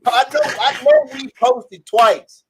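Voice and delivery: high, slow and laughing; laughing admission. Real, not acted. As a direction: confess it through laughter.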